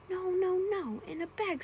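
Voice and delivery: high, loud goblin objection; insistent high-pitched goblin. A narrator's reading. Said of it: "said the goblin".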